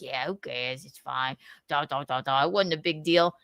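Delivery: mild imitation of a shrug-off; mumbled quote. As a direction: toss it off under the breath like nobody cares. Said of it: "and he's like".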